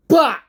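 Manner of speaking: sudden loud high "bah!" as the pipe hits; impact yell. Retold or imitated. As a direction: shout the blow out like a sound effect. Pipe shot in wrestling story.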